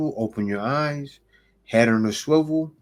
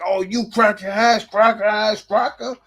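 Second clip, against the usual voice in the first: mock insult; 7 semitones higher, 4 dB louder than usual. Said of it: "you can't be out there like".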